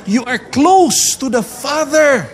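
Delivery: high, loud, slow declaration; emphatic proclamation. Real, not acted. Preacher declares closeness to the congregation, emphatic proclamation. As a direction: proclaim it to the whole room.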